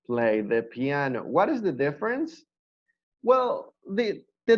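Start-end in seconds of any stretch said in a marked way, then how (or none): 0.0-1.2 "I cannot" stretched very slowly; slow formal model. Shown as a demonstration. Teacher modelling the formal form, very slow. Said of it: "You can also say".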